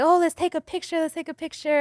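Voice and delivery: put-on eager coaxing; imitated pushy eagerness. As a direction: act out an insistent man. Imitates pushy man wanting photo.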